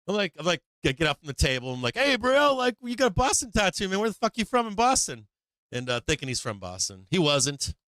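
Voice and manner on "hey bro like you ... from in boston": high-pitched, brash call to a stranger; brash hail. Retold or imitated. Older guy accosting a tattooed kid about Boston, brash and high. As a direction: hail him like an over-familiar local.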